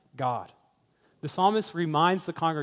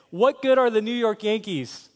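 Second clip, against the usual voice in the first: higher, louder scornful challenge; mocking taunt; 6 semitones higher, 5 dB louder than usual. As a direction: taunt a fan about the team. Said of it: "if I began to mock".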